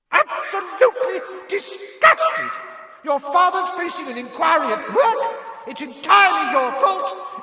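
Mrs Weasley's howler yell. A narrator's reading. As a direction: bellow like an enraged mum filling a hall. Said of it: "Missus Weasley's yells".

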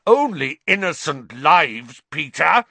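scathing, high-pitched reproach; terrible fury. A narrator's reading. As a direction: hurl it back angrily. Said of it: "with a terrible fury".

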